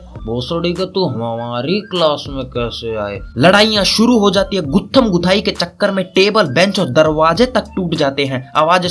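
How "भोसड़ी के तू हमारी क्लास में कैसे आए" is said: slow, drawn-out, softened mock challenge; schoolkids' voice. Small pupils confront seniors in their classroom, drawling mock challenge. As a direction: stretch it out like kids confronting intruders. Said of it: "के बच्चे तुम सबको देख के बोलते हैं".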